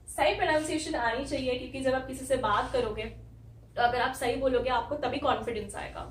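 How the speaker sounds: usual speaking voice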